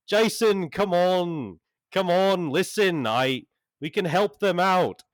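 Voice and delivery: high, loud, urgent coaxing; eager pleading. Acted. John pleads with Jason to help the party. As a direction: chase after him, begging.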